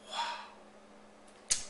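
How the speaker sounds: breathless, whispered wow; softly whispered amazement; hushed wow. Real, not acted. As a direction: breathe it out in quiet awe. Awed reaction after the verse.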